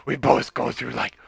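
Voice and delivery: low, soft raspy smoker mom; smoker's rasp. Acted. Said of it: "This is Barry's mom now".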